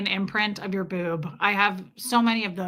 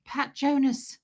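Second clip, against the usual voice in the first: higher, tiny Aussie imitation voice; attempted Australian accent; 4 semitones higher, slower than usual. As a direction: try her little Australian koala voice. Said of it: "I can't imitate her".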